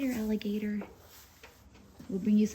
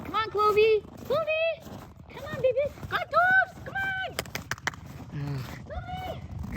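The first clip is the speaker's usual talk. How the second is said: calling dogs; slower than usual. Real, not acted. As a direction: call the dogs from far off. Calling the dogs across field.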